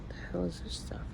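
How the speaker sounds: puzzled whisper under the breath; whispered aside. Real, not acted. Muttering at the TV while filming, whispered. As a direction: hush it, just to yourself, puzzled.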